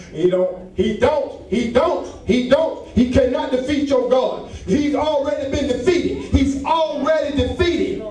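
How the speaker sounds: rising preacher's cadence, calling out; full-force preaching. Acted. Preacher calling out to the congregation, fervent.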